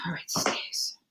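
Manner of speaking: whispered aside to herself; whispering. Real, not acted. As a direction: breathe it almost silently. Muttering card aside to self.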